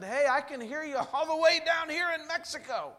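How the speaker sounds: fast, high, eager phone greeting; excited call. Retold or imitated. Calling the radio station from Mexico, thrilled and fast. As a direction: blurt it out thrilled to hear him. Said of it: "I got so excited".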